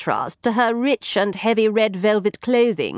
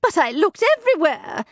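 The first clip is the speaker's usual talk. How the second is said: tag; 9 semitones higher than usual. Acted. Maid protests.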